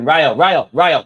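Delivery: loud urgent call of the keeper's name. Real, not acted. Fan watching the game calls to his goalkeeper Raya, loud and urgent. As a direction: shout the name to warn him.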